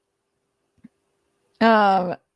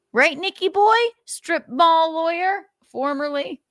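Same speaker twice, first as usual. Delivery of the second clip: mocking taunt; 10 semitones higher than usual. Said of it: "mocking Nick".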